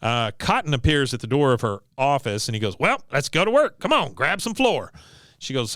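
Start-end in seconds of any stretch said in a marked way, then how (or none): 2.8-4.9 higher-pitched, brisk sergeant's command; mock drill orders. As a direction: rouse a recruit, playfully acted. Acting Cotton ordering Peggy to work.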